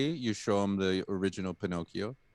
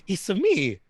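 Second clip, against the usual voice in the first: Mario voice; 8 semitones higher, 4 dB louder, slower than usual. Pretend. Mario impression after Italian jokes.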